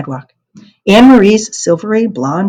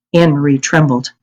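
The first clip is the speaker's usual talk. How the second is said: ordinary delivery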